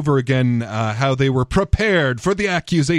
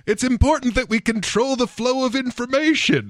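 sing-song mockery; 7 semitones higher than usual. Mocking quote in sing-song.

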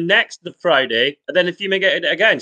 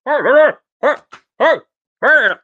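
calling out; 8 semitones higher, 5 dB louder, slower than usual. Acted. Waking an unconscious friend.

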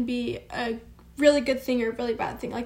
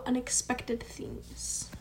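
breathy hushed whisper, slowed right down; 9 dB quieter, much slower than usual. Real, not acted. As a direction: drop to a slow whisper. Whispering after talk of change.

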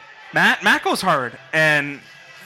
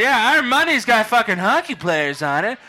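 put-on drunk voice; 6 semitones higher than usual. Drunk self boasting to cabbie.

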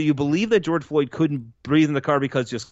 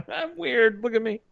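laughing weird-guy imitation; 8 semitones higher than usual. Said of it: "Does he sound like this?".

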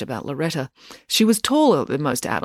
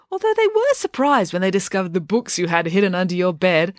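ordinary delivery

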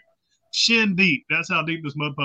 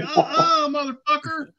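much higher mock cop refusal; cop refusing; 11 semitones higher than usual. Cop refusing the muddy drunk, high mocking no. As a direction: shut him down hard, hammed-up. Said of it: "They was like".

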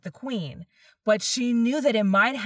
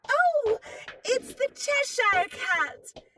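put-on relieved young girl, a little higher; a bright girl spotting a friend; 8 semitones higher than usual. A narrator's reading. Alice greets the cat.

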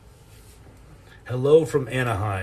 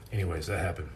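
normal talking voice

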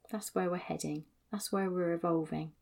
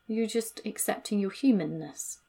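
ordinary talk

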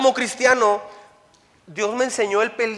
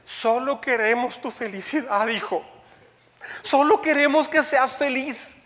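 doting parents; 5 semitones higher than usual. Retold or imitated. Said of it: "y ellos dicen".

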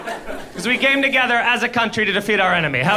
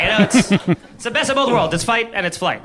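ordinary talk, nothing marked

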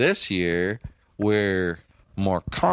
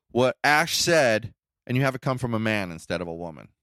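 ordinary talk

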